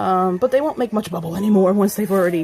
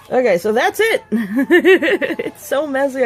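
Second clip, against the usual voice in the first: higher, giggling through the words; laughing; 6 semitones higher, 4 dB louder, slower than usual. Real, not acted. Vlogger giving up on messy bath melt, laughing. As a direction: break into laughter at the mess.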